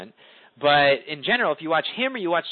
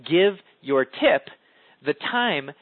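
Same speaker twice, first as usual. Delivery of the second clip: slow tempo demo; much slower than usual. Shown as a demonstration. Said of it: "that's what it means by tempo".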